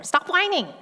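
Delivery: half-joking parental scolding; mock parent snapping. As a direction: scold the kids sharply. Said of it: "That's how I talk to my children".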